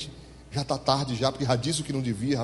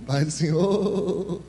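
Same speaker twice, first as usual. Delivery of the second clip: sheepish voice; much slower than usual. Retold or imitated. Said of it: "com voz de ovelha".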